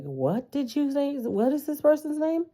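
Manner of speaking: high-pitched, incredulous "What did you say?"; mock baffled relatives. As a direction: play the stunned family squinting at the name.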